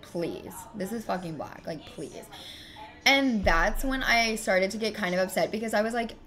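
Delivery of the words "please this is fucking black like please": much softer, muttered scoff to the camera; scoffing aside. Real, not acted. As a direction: mutter it in disbelief.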